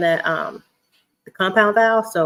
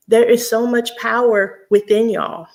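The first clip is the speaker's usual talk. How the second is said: ordinary talk, nothing marked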